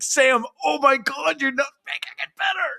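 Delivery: high, exasperated near-shout; exasperated outburst. Real, not acted. Fan vents at the Red Sox CEO, high and exasperated. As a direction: throw up your hands at him.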